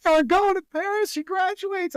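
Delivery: hammed-up joy at finally going; imitating thrilled mom. A mother telling her travel helper the Paris trip is on, mock thrilled. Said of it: "she's like".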